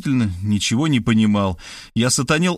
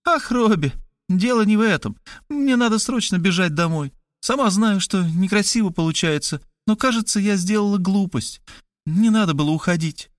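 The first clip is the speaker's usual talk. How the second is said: a higher, lighter woman's voice; Kristina's voice; 8 semitones higher than usual. Acted. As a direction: play the sister, pitched up and urgent. Kristina frets.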